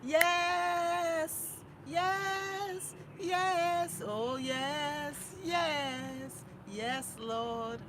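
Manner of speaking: loud, high yeses fading down; joyful yeses. Real, not acted. Grateful mother saying yes, fading from loud. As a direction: exult then settle.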